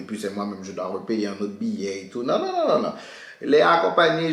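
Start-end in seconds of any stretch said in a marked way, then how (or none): 2.2-2.9 high emphatic no. Real, not acted. Insisting she was no monster.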